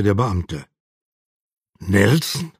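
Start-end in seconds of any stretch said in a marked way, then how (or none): 1.8-2.5 low, questioning rise in disbelief; understated incredulous question; disbelieving. A narrator's reading. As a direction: ask it as if you can't believe it. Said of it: "rief Bruce ungläubig".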